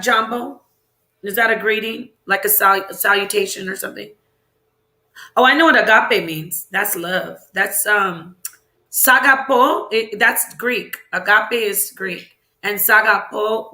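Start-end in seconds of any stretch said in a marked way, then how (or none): none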